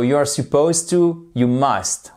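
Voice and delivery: normal talking voice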